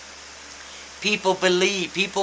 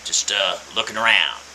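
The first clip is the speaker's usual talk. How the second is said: put-on raspy old man; 6 semitones lower than usual. Old customer waving off the salesman, in a lower raspy voice. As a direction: rasp it out like an old customer brushing off help. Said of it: "says in a raspy voice".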